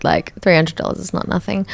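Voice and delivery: ordinary delivery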